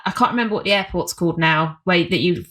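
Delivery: ordinary delivery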